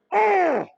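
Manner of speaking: high exasperated "Oh!" groan; frustrated groan. Retold or imitated. Ahab sulking on his way home, frustrated groan. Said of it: "He's like".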